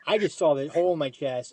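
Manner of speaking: normal talking voice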